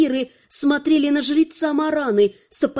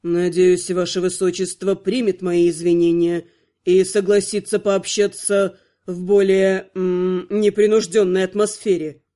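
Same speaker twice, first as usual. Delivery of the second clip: suave vampire lord; 7 semitones lower than usual. A narrator's reading. Archvampire courting the princess.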